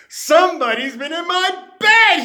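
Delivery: high, loud outraged yell; shouting through the house. Acted. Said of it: "he yelled".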